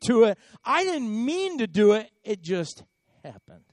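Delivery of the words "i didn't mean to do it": ordinary talk, nothing marked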